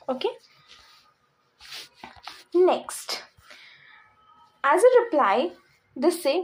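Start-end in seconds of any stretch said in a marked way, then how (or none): none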